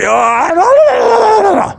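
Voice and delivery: high, loud yelled oh; sudden yell. Real, not acted. Vlogger releasing tension in the woods, yelling. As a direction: let out a wild yell.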